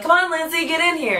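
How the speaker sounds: high-pitched imitation of coaxing; coaxing call. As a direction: imitate castmates calling her in. Quotes cast coaxing performer to join.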